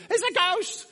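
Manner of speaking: high, loud cry of alarm; terrified cry. Acted. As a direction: cry out like scared disciples seeing a ghost. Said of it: "the disciples are ultra scared".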